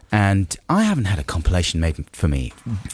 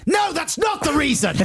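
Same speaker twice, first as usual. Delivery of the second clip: indignant shout. Real, not acted. Indignant denial, rejected pilot.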